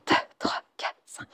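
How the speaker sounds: soft, breath-led beats; whispered count. Real, not acted. Counting breaths during the exercise.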